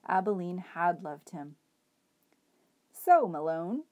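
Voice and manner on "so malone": louder hobo address. A narrator's reading. Hobo Bull greeting the rabbit, lightly voiced. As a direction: call the rabbit by name. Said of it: "said the man".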